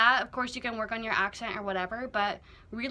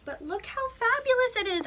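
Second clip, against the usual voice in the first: much higher, louder, gushing over the jacket; thrilled showing off; 13 semitones higher, 5 dB louder than usual. Real, not acted. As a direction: rave about how fabulous it is.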